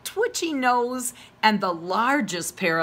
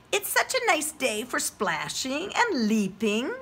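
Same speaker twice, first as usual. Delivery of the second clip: higher, gentle mother frog; 6 semitones higher than usual. Acted. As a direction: sing it out like a kind frog mum.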